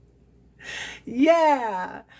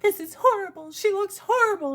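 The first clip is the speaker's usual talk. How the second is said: appalled grandma; 10 semitones higher, faster than usual. Retold or imitated. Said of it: "My grandma was like".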